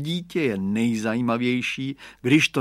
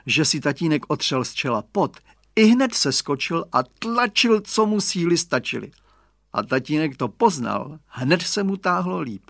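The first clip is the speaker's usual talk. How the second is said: usual speaking voice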